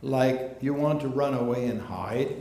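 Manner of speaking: ordinary talk, nothing marked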